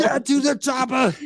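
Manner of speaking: hammed-up action-movie shout; Arnold impression. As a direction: bark it like an action hero.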